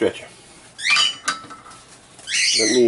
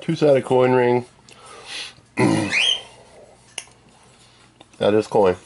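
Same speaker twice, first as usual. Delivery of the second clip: talking around candy in mouth; mouth full; 4 semitones higher than usual. Real, not acted. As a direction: speak with a piece of candy in your mouth. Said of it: "Sorry, I'm eating a piece of candy".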